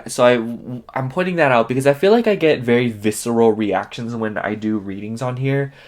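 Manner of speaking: normal talking voice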